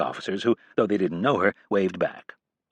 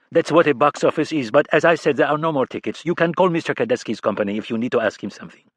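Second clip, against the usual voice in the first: condescending Frenchman, louder and higher; sneering French accent; 5 semitones higher, 3 dB louder than usual. A narrator's reading. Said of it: "A demi sneer crossed his face".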